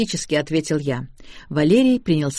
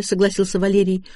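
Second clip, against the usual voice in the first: ordinary delivery, nothing marked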